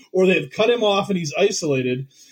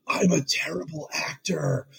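slow, lowered confiding voice; John Malkovich; 2 semitones lower, 6 dB quieter, slower than usual. Retold or imitated. Actor confiding in his co-star between takes, hushed and slow. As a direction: lean in and murmur it slowly. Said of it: "Malkovich, like, pulls him in and leans into him".

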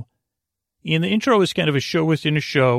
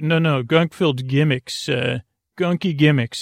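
ordinary talk, nothing marked

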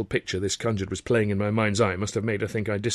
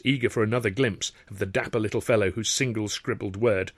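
ordinary delivery, nothing marked

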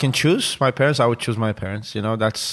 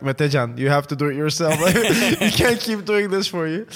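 higher mock-scolding neighbor voice, lightly voiced; 4 semitones higher than usual. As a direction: imitate the neighbors nagging, half-joking. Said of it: "they said, like".

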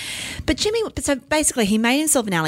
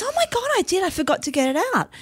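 surprised; 11 semitones higher, faster than usual. Retold or imitated. Son remembers forgotten chicken.

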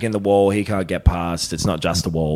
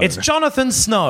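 excited reveal; 10 semitones higher than usual. Real, not acted. Dramatic reveal.

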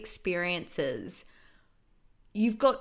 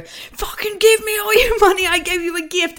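much higher, much louder furious shouting demand; aggressive street hustler yell; 11 semitones higher, 11 dB louder than usual. Retold or imitated. Street seller turns on the tourist, shouting angrily. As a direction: roar it like a hustler turning nasty. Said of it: "And then they go".

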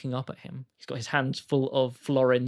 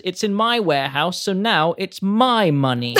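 sneering; 5 semitones higher, 8 dB louder than usual. Acted. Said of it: "Mister Penny sneers".

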